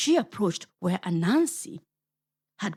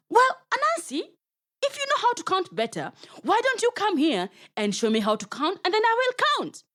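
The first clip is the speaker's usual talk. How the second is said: sweetly innocent hen; 12 semitones higher, faster than usual. Acted. Guinea Fowl tricks Anansi.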